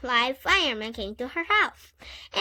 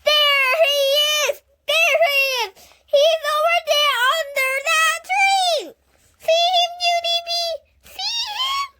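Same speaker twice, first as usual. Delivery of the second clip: very high loud excited squeal; 8 dB louder than usual. A narrator's reading. Grace jumping up and down spotting Warren, very high and loud. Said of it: "very excited".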